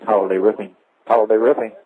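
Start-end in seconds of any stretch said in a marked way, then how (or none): none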